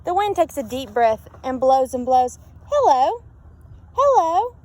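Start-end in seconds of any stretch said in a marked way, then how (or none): 2.7-4.6 calling out. A narrator's reading. Lighthouse calling over the wind.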